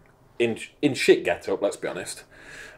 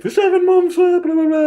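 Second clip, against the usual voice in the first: mocking blah blah; 7 dB louder than usual. Real, not acted. Mocking repeated diet talk.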